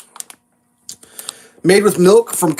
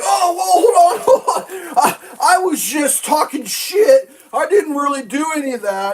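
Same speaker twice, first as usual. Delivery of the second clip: panicked backpedal; 9 semitones higher than usual. Retold or imitated. Governor caught bluffing, backpedalling to the feds in high panicked yelps. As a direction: yelp it out like a man caught bluffing.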